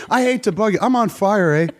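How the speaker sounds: high, apologetic mock Canadian; Canadian lilt with questioning "eh"; polite Canadian accent. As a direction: parody a too-polite Canadian raising an alarm. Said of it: "they're so polite".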